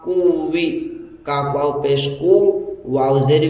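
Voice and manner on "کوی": ordinary talk, nothing marked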